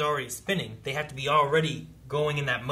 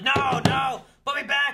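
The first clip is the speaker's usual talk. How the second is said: high, loud frantic protest; mock panicked pleading; 13 semitones higher, 7 dB louder, slower than usual. Retelling captives pulled from the machine, mock panic, high and loud. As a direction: cry it out like a desperate captive.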